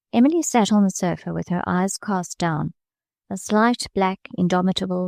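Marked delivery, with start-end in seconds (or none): none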